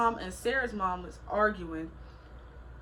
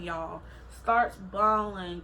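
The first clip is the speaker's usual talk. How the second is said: ordinary delivery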